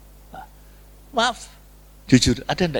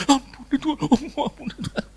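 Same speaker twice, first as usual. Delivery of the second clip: high-pitched imitated begging for forgiveness; mock pleading prayer; 12 semitones higher than usual. Someone praying over a sufferer, mocking high plea. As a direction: parody a fervent intercessor, high and pleading.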